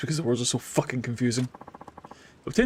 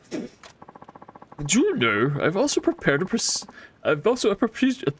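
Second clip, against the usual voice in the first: voiced NPC accent; 3 semitones higher than usual. Streamer voicing a game merchant, lightly accented. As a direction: read the merchant's lines in a hint of a funny accent. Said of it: "This accent has changed".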